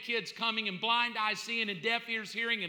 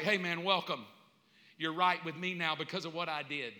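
lower, calm, reassuring tone; gentle welcome; 6 semitones lower, 3 dB quieter than usual. Acted. Jesus welcoming a believer after death, gentle welcome. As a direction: receive the newcomer tenderly and unhurriedly.

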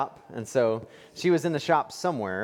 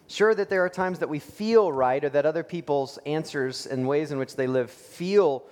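normal talking voice

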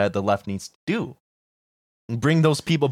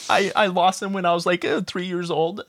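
high-pitched whiny fanboy voice; squeaky mocking fan voice; 11 semitones higher than usual. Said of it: "I'd make him cry being like".

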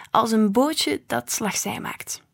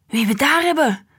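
louder and higher, delighted to see them; delighted grandpa greeting; 5 semitones higher, 5 dB louder than usual. A narrator's reading. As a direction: boom out the welcome with joy. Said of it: "bromde hij opgetogen". (opgetogen begroeting van opa)

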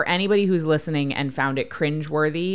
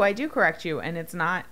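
normal talking voice

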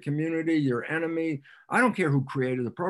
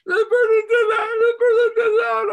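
high, loud whiny singsong; mocking singsong; 10 dB louder than usual. Mimicking a therapy client rehashing blame, high sung whine. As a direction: sing the complaints in a mocking whine.

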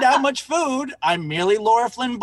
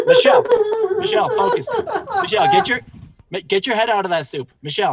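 raised voice, high, nagging a daydreamer; mock scolding; 9 semitones higher, much faster than usual. Sandwich guy calling out to Michelle, mock scolding. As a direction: snap someone back to attention, hammed-up. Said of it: "the guy tells me this".

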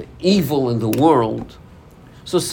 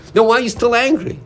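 higher, louder, insistent challenge; mock exasperated questioner, raised and fast; pressing question; 9 semitones higher, 5 dB louder, faster than usual. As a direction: push him for an answer. Pressing an estranged brother.

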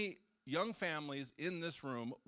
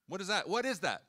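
excited exclamation; 5 semitones higher, 7 dB louder than usual. Real, not acted. Preacher exclaims rhetorically.

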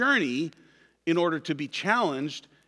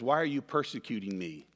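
hushed, softer question; soft voice from heaven; 6 semitones lower, 6 dB quieter than usual. Acted. Pastor voicing God to listeners with eyes closed, softly. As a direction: let the voice come quietly into their minds. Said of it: "And that voice says".

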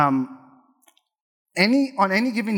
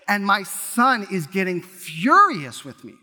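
ordinary talk, nothing marked

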